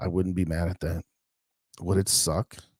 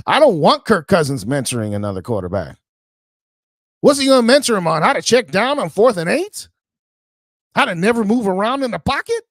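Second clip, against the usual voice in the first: higher and louder, fast and indignant; heated rant; 10 semitones higher, 8 dB louder than usual. Real, not acted. Fan host raging about Cousins mentoring a rookie, loud and higher. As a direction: shout your disbelief at the idea.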